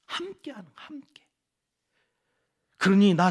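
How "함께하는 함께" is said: whispered, high and hushed; a hushed echo of the key word. Acted. Whispered repetition for emphasis.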